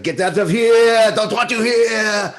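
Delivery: high, drawn-out yell, mock French accent; mock French-accent shout. Rival auditioning for the chef part, loud mock French yell. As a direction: bellow it slowly in a hammed-up French accent. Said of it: "came in with a French accent".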